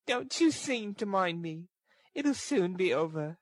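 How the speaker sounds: put-on high, frail girl's character voice; high-pitched resignation; weak girl's voice. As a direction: dismiss concern in a high register. Weak girl waves him off.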